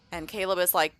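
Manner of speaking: ordinary delivery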